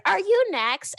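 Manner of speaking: mock nosy fan. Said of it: "asking her".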